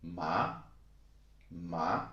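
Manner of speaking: "ma" starting low and rising; second tone. Shown as a demonstration. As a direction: say "ma" like a short question. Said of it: "starts low and then it goes up".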